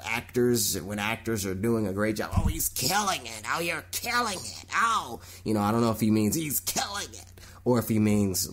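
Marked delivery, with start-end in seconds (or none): 2.4-5.2 mock gushing praise. Mock praising actors phrase.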